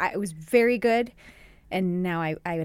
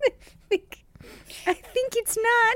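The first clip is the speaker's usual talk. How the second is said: laughing. Real, not acted. Laughing at own doubtful accent, high and laughing. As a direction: squeal it out while laughing.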